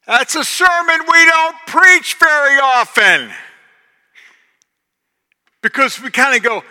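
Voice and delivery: loud high preacher shout; full-force sermon shout; preaching at full force. Acted. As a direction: hammer the point home.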